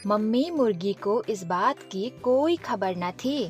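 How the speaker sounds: ordinary delivery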